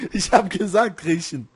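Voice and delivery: loud laughing insistence; laughing shout. Real, not acted. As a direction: yell it through laughter.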